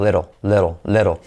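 "Little" with its final L barely sounded, like "lero"; soft final L. Shown as a demonstration. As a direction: let the last L of "little" go. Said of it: "y al final no tanto".

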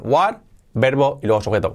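ordinary talk

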